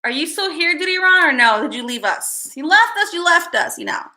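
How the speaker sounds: usual speaking voice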